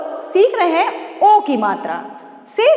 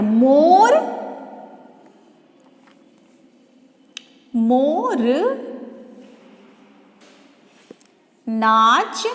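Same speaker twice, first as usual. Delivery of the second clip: each word spaced out with long pauses; word-by-word dictation; 3 semitones lower, much slower than usual. Shown as a demonstration. Teacher building a sentence word by word, slow dictation. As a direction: dictate slowly for children to write. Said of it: "अब कोई भी छोटा सा वाक्य आप बना सकते हैं।".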